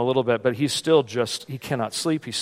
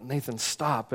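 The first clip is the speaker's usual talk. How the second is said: tired telling-off; 10 dB quieter, slower than usual. Retold or imitated. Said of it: "and I'm like".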